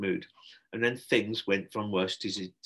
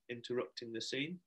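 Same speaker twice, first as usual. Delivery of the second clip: ordinary delivery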